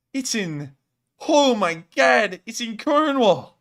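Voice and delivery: loud, slow, high exclaim; oh my God, real delight; excited. Real, not acted. Spotting Cornwall on the map, loud and high.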